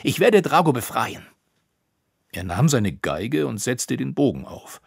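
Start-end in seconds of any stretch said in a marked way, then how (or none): 0.0-1.2 high, loud declaration; forceful, defiant vow; determined cry. A narrator's reading. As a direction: announce your resolve with a raised voice. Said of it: "rief er".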